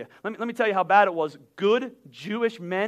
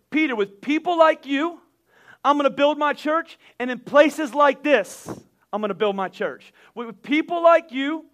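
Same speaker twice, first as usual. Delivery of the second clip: full-force preaching; 4 semitones higher, 5 dB louder than usual. Acted. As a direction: preach it out and lean on every like. Preaching full force on Jesus' words.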